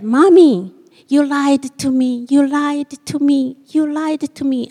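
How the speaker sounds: a hint of a tearful child; upset little girl. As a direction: voice the hurt kindergartner. Upset child voiced in story.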